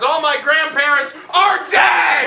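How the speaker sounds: high, indignant comic yell; shouted punchline. Acted. Comic justifies mocking old people, indignant shout. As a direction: build to a yell on the last words.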